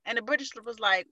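usual speaking voice

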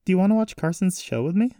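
eager high-pitched invitation; inviting granddaughter. Acted. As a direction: ask the uncle along, pitched well up. Voices Jessie.

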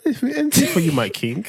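high, gushing, mock-devoted voice; mock adoring partner. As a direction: play it as a swooning servant.

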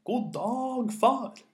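high, loud storyteller's shout. A narrator's reading. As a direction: shout the greeting as if to deaf ears. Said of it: "ropte så høyt han kunne".

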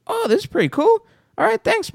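high-voiced retold reply; polite imitated reaction. Retells a stranger's polite reaction.

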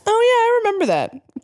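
very high, exaggerated put-on voice; trying to be gothic. A narrator's reading. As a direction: overdo it like a wannabe goth. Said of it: "trying to be all guffic".

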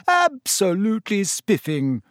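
high-pitched theatrical gushing; mock-pompous. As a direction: overdo the posh delight. George continues the pompous parody.

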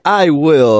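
loud, high, hammed-up promise; mock eager reply. Teenager answers old lady's send-off, high and loud mock eagerness. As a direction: belt it out as a movie quote.

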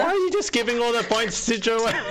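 high-pitched playful complaint; mock protest. Rival player complains about points going to Joanna, mock protest.

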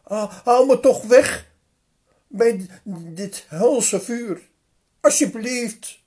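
wanhopig smekend, hoog en luid, wegzakkend naar het eind. Acted. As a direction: smeek om verlossing uit het vuur. Rijke man smeekt in hels vuur. (rijke man smeekt Abraham om verkoeling, van luid naar zachter)

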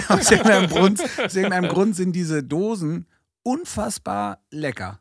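laughing through the words. Real, not acted. Laughing after the Brunz slip.

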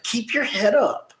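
high, drawn-out urging; encouraging pep talk. Retold or imitated. Host acting out cheering someone on a crappy day, encouraging.

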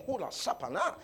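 mocking sing-song gibberish; sing-song mock babble; mock tongues babble. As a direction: parody airy spiritual babbling. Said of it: "bubbling in the air".

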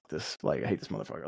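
low, quiet grumble to oneself; muttered disgust. Acted. Reacting to posts while scrolling, muttered low and soft. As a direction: drop the voice and grumble it privately.

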